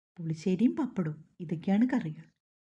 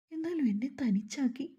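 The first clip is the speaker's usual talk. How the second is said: എന്നാലും repeated higher and softer, voice breaking; mild narrated crying, rising to tears; 6 semitones higher, 4 dB quieter than usual. A narrator's reading. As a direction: let the second എന്നാലും crack into a sob. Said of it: "പൂർത്തിയാക്കും മുമ്പ് അവൾ പൊട്ടിക്കരഞ്ഞു".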